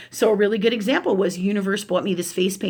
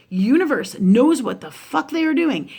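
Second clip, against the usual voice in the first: ordinary talk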